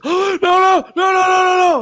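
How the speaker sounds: put-on gasp, then very high, loud panicked child; mock panic, rapid high-pitched shouting; imitated freak-out. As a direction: shriek the no's like a panicking little girl. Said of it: "she started freaking out".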